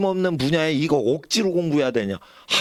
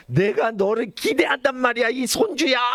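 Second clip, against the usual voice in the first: mock angry scold; 10 semitones higher than usual. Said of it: "이러면서 막 화를 내시는 거예요".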